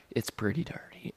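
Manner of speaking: soft whispered relay; imitating muted reply. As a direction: whisper it as the other party. Voicing dealer's muted reply.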